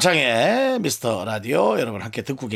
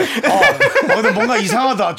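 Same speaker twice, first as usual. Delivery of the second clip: laughing; 9 semitones higher, 4 dB louder, slower than usual. Real, not acted. Laughing through silence test.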